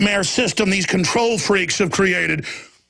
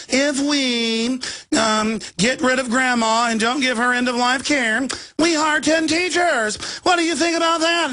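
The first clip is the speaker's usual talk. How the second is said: mince it out smugly, a touch higher; prissy Nelly; 7 semitones higher than usual. Retold or imitated. Host mocking an elite's end-of-life pitch, simpering voice. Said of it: "Wise old Nellies that rule us".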